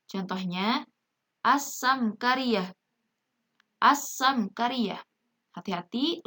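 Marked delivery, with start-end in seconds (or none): 1.4-2.7 slow "as-samkariyah" with a long S; silent lam. Shown as a demonstration. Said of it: "lamnya jangan sampai terdengar ya". 3.8-5.0 slow "as-samkariyah" with a long S; silent lam. Shown as a demonstration. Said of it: "lamnya jangan sampai terdengar ya".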